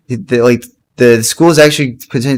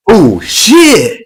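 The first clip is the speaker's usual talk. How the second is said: mock shock; 7 semitones higher than usual. Friend's shocked reaction reenacted.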